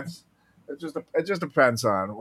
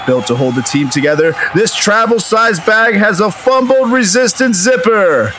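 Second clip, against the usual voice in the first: punchy sponsor read, louder and upbeat; 8 semitones higher, 9 dB louder than usual. Acted. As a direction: sell it like a football pregame hype. Ad read as football lineup.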